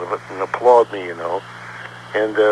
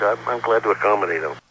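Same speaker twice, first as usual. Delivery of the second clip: ordinary delivery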